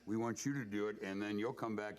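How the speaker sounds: ordinary delivery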